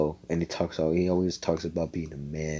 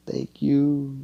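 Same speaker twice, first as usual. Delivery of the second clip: tearful thanks; 8 semitones higher than usual. Retold or imitated. Girl voiced while crying.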